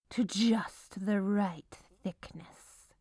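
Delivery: usual speaking voice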